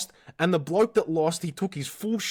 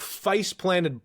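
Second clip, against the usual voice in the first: drawn-out repeat; 3 semitones higher than usual. Real, not acted. Repeats face planted, slower.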